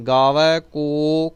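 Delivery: ਕੋ ਲੰਬਾ ਕਰਕੇ; lengthened ko. Shown as a demonstration. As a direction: stretch the ਕੋ out. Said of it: "ਕੋ ਨੂੰ ਲੰਬਾ ਕਰਨਾ".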